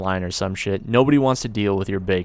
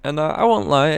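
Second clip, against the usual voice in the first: normal talking voice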